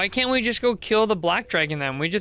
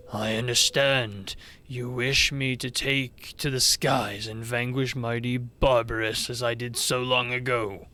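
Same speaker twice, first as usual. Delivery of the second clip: low, stately dragon voice; grave and measured, like an ancient hero; solemn old dragon; 5 semitones lower than usual. Acted. Old dragon is asked to fight once more, low and solemn.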